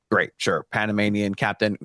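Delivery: ordinary talk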